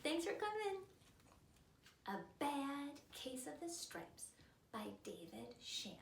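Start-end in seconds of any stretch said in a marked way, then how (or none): none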